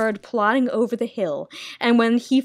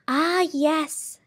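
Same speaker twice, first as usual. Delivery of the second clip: sighing; 6 semitones higher, 3 dB quieter than usual. Acted. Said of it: "she sighed".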